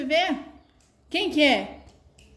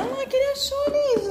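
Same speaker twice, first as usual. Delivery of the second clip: much higher cooing voice with a laugh; 13 semitones higher than usual. Real, not acted. Host coos to the baby about his find.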